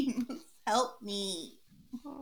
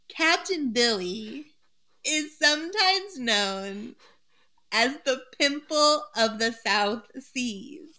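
slow; slower than usual. Acted. Slow dramatic reading, joke name.

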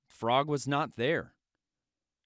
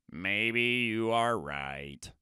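slow, softer, lower Toad; doubting Toad; 5 semitones lower, 5 dB quieter, much slower than usual. Acted. Toad giving in to the turtle, sad and slow. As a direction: concede it slowly as a sad Toad.

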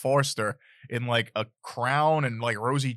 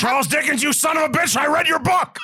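shouting; 13 semitones higher, 6 dB louder than usual. Acted. Shouting at Dickens in movie.

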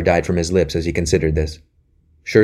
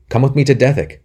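pressed higher and louder, with stress on to; urging; 8 semitones higher, 5 dB louder than usual. A narrator's reading. Anthony presses Robin to come, urgent and louder. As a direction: insist on it this time. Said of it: "said Anthony again".

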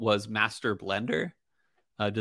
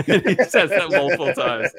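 normal talking voice

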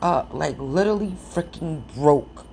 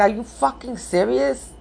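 disbelieving outburst; 8 semitones higher than usual. Retold or imitated. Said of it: "And I'm like".

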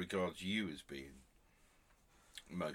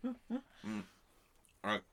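talking with a mouthful; mouth full; 14 semitones higher, 3 dB quieter than usual. Real, not acted. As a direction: speak round a mouthful of food. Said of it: "don't talk your mouth full".

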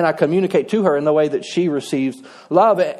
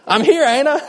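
high, mocking husband voice, rising; gruff unaffectionate husband; 7 semitones higher than usual. Preacher mocks his own unromantic side, high teasing voice. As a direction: lampoon the husband who thinks words are enough. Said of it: "I'm more of a".

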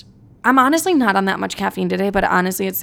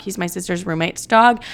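normal talking voice

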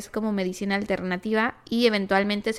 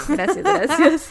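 laughing thanks; 7 semitones higher, 5 dB louder, slower than usual. Real, not acted.

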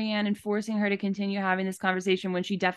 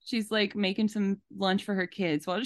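ordinary delivery

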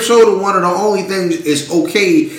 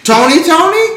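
higher-pitched repeated name, searching; feigned recall; 12 semitones higher than usual. Acted. Asked about Tony while pretending to know, feigned recall. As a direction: act like the name rings a bell. Acts out struggling to place Tony.

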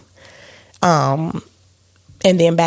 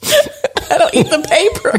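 words bubbling through real laughter, higher; laughing denial; 11 semitones higher than usual. Real, not acted. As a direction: protest while cracking up. Laughing denial to teasing husband.

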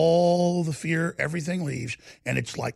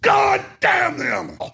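very slow, high, shouted repeated curse; Charlton Heston; 14 semitones higher, 4 dB louder, much slower than usual. Retold or imitated. Host imitating Heston again, slow anguished shout. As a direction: drag out each curse in a roar. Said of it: "He's saying".

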